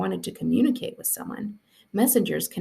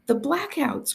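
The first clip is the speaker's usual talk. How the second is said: high urgent outburst; cried out; 7 semitones higher, slower than usual. A narrator's reading. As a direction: blurt it out in alarm. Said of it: "cried Dune".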